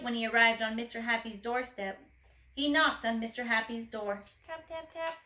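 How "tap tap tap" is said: soft, higher little taps; soft tapping. A narrator's reading. Mister Quiet tapping softly on the door. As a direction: tap it gently. Timid knock.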